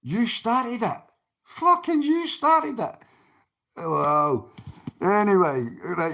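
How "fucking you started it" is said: angry repeat. Real, not acted. Streamer snapping back at the viewer who started it, angry and high. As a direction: hammer it home, exasperated.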